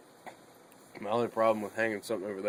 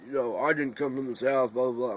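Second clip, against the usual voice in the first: louder, higher mock rant; hammed-up dad going off, louder and higher; mocking dad's rant; 4 semitones higher, 6 dB louder than usual. Son mimics his dad ranting about the South, mocking and louder. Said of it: "he starts going".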